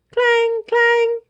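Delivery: high, ringing clang of an alarm bell. A narrator's reading. Narrator voices the monster alarm bell ringing. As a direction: ring it out like a bell.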